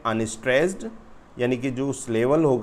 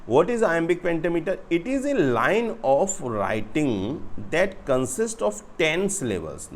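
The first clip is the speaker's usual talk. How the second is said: slow, higher-pitched textbook read-out; reading aloud; 5 semitones higher, much slower than usual. Real, not acted. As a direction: read the English out slowly, word by word. Reads the written answer aloud.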